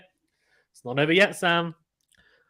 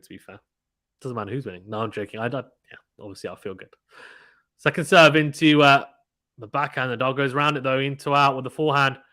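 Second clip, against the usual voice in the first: normal talking voice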